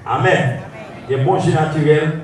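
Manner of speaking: ordinary talk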